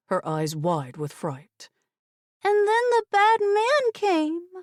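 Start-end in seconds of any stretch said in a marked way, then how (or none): 2.4-4.5 put-on high, slow child's voice; a small girl telling something scary; scared little girl. A narrator's reading. Frightened niece telling her aunt, high slow child voice.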